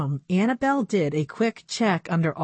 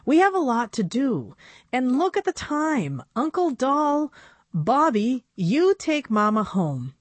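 put-on slightly higher girlish voice; a hint of a young girl pleading; lightly voiced young girl; 7 semitones higher than usual. Narrator voices Annabelle.

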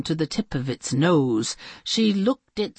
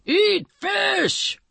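much higher, shouted monster joy; happy sea monster; 14 semitones higher than usual. Acted. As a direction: cheer it like a glad sea monster. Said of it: "it said".

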